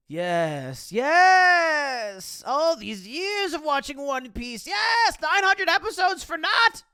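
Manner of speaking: high, loud overjoyed yelling; ecstatic shouting. Pretend. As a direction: scream with fanboy joy. Hammed-up weeb shoe ecstasy.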